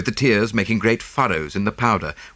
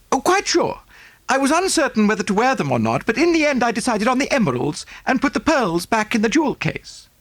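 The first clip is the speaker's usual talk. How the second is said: high, breathless socialite voice; gushing rich American lady; 14 semitones higher than usual. Acted. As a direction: pour it out like a fussy rich lady. Mrs Oppleson voiced.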